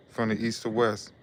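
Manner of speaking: ordinary delivery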